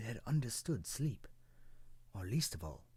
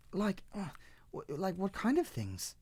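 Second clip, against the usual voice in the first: high, eager little cloud; little cloud asking; 10 semitones higher than usual. Acted. Cloud presses the tree for more, high and eager. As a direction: press the question high and curious. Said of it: "the cloud asked".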